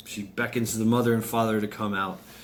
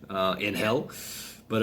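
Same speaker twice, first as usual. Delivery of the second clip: ordinary delivery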